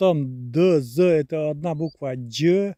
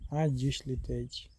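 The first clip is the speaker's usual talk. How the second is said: quiet slow repeat; 2 semitones lower, 9 dB quieter, slower than usual. Shown as a demonstration. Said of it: "запомните или нет".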